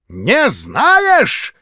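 high, loud outraged exclamation; scandalised shout; shocked cry. A narrator's reading. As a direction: cry out in pious alarm. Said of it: "крикнула она".

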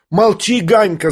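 high-pitched shout at a brother; boy's angry cry. A narrator's reading. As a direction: snap it out high, upset. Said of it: "закричал Коля". (звонкий сердитый выкрик)

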